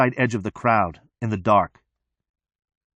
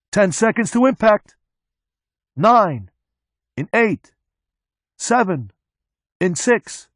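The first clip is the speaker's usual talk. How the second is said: countdown; 10 semitones higher, slower than usual. A narrator's reading. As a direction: count down to the impact. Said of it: "And he says".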